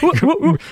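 very high falling hoot; dumb hooting sound. Retold or imitated. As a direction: hoot like a silly dinosaur. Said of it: "this hooting sound".

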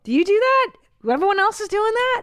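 high, loud disbelief; incredulous. Real, not acted. To co-host about double dipping, high incredulous questions. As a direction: ask in shocked disbelief.

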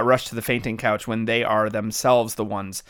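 usual speaking voice

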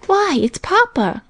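put-on high, slow, delighted little girl's cry; a child spotting her father ride up; excited cry. A narrator's reading. Little girl calling out to her father, thrilled. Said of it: "cried Minnie".

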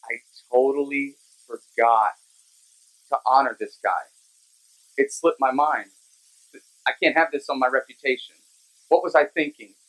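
the king catching his own mistake, voiced a bit low. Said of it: "And the king is going".

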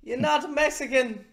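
high, put-on exasperated parent voice; scolding parent. Acts out Beto's embarrassed parent scolding.

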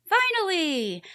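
ordinary talk

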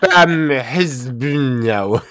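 slow, loud, high outraged protest; indignant outburst. Retold or imitated. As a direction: drag out the offended fury. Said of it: "so she's like".

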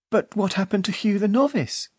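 put-on high, eager child's question. A narrator's reading. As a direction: ask like a curious child.

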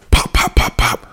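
quick unvoiced popping shots; imitated gunshots. Dad shoots child in acted quarrel, mouthed gunshots. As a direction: pop out a burst of gunfire by mouth.

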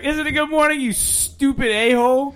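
high, sarcastic fake-mean retort; mock grumpy snap. Host hamming up how he'd greet his friend, mock snarky. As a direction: play up his own grouchiness for a laugh. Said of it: "I'd be like".

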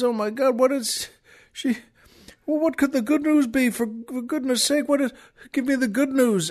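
high, halting acted distress; upset, near weeping. Husband just told his wife is dead, acting upset. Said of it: "He's so upset".

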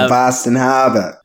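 Harbor as "HAH-bah", Boston style; Boston accent. Acted. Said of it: "say it with a Boston accent".

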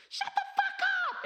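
put-on high, loud squeal of a three-year-old cursing, laughing through it; screeching toddler. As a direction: shriek it like a tiny furious kid. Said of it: "the kid's like".

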